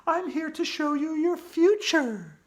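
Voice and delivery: high, eerie ghost voice; spooky voice. Acted. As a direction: announce yourself spookily. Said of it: "said in a spooky voice".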